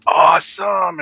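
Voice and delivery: a high, loud, delighted "Awesome!"; excited exclamation. Retold or imitated. Relative hearing the name, excited shout. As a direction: shout it like a thrilled friend. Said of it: "getting all excited".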